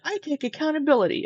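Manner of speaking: high, sing-song mock of a hollow apology; mocking Amberlynn. Co-host mocking Amberlynn's hollow apology, high and sing-song. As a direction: parrot her empty words in a lightly put-on voice. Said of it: "Just like the".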